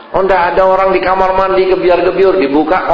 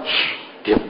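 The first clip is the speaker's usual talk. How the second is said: whispered shush, much softer; hushing whisper; 9 dB quieter than usual. Retold or imitated. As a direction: hush the child in a sharp whisper. Parent hushing child in sermon.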